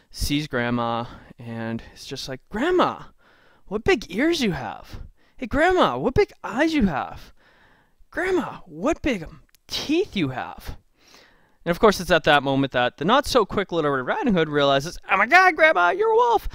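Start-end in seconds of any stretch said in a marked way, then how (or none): 2.5-10.8 put-on much higher, slow, wide-eyed child voice; little girl wondering. Red questioning disguised wolf. 15.1-16.5 much higher, louder shriek of realisation; shocked scream. Acted. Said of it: "running and screaming".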